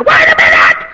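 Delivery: sudden very high, loud cry. Retold or imitated. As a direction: yell it through the bars, desperate. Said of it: "cry out to me like that".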